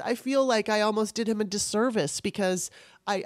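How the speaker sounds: ordinary delivery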